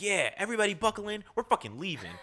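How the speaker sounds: excited crew announcement. Retold or imitated. Crew announcing departure, exasperated.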